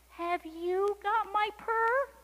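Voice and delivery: high, slow kitten question; pleading kitten. A narrator's reading. Kitten asks the ducks for her purr, high and pleading. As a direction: raise the voice right up into the question.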